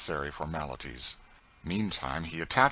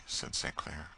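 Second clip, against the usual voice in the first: usual speaking voice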